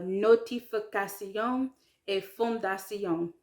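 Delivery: "notification" said in French, ending "ka-see-on" with each syllable sounded; French pronunciation. Shown as a demonstration. Learner showing it's not the English word, French pronunciation. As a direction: give "fondation" a nasal French "-see-on" ending. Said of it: "have to say each syllable".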